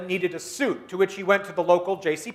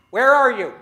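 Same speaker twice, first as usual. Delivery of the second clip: loud, higher call through rubble; 4 semitones higher, 8 dB louder than usual. Shown as a demonstration. Speaker calling to a buried crowd, loud. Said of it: "Let's try it again".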